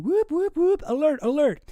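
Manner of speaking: high, loud whooping siren; imitated siren. Streamer voicing the siren in a headline, mock alarm. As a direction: whoop like an alarm, then announce the alert. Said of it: "there's a siren".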